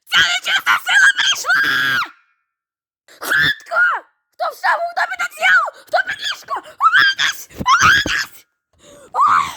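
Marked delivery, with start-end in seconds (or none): 0.1-1.5 high, loud cry that the evil spirit came; frightened shout. Pretend. Telling the others the evil force has come, shrieking. Announcing monster arrived. 5.9-8.5 high shrieking call to hide; shouting to hide. Pretend. Ordering the toys to hide under the bed, shrieking high.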